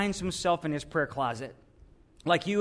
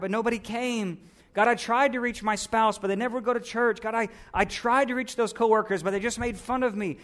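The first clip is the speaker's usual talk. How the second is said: higher, aggrieved protest of wasted effort; self-righteous complaint; 4 semitones higher than usual. Acted. Elijah telling God he deserves better, self-righteously aggrieved. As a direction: plead your own merits with mounting grievance. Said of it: "self-righteousness".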